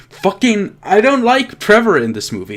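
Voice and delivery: ordinary talk, nothing marked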